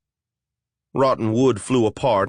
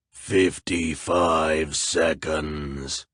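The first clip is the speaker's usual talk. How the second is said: lower, very slow monster voice; wet monster voice; 6 semitones lower, much slower than usual. Acted. A flesh golem setting a riddle for intruders, slow and lower. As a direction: drag each word out like a lumbering golem.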